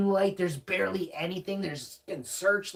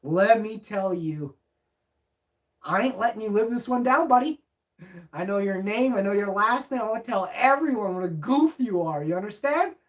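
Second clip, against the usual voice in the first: louder, higher threatening rant; heated threat; 4 semitones higher, 6 dB louder than usual. Retold or imitated. Telling the officer he'll never live it down, heated. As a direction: lean in and threaten him.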